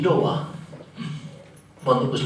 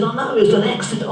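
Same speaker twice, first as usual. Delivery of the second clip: fast, high defensive excuse; hurried excuse; 8 semitones higher, faster than usual. Retold or imitated. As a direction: rush the excuse out.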